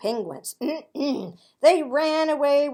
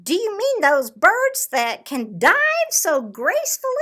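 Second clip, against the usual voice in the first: high, loud silly bird voice; goofy Tacky; 7 semitones higher, 8 dB louder than usual. Acted. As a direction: ask it like a cheerful oddball bird. Tacky asks hunters.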